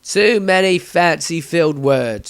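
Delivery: loud, rough villager, rising into a rant; a zealot justifying the burning; loud righteous mob man; angry villager. Acted. Villager voiced in a story.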